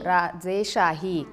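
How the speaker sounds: राजेशाही said very slowly, syllable by syllable; correcting a child's misreading, slowly; slow correction. Shown as a demonstration. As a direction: spell out राजेशाही slowly so the child can read it right. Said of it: "रोषशाही".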